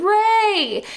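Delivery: high mock yell of a name; imitated yell. Finn shouting for Rey, a hammed-up high yell. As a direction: call out to her in alarm, hammed up. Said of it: "she hears Finn yelling".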